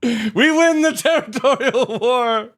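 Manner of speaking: slow, high triumphant bellow; man down shout. Acted. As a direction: proclaim victory loud and slow. Victory after fight.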